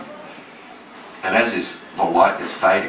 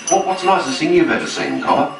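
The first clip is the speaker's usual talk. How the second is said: eager question; 10 semitones higher than usual. Retold or imitated. Pressing his mate with a question.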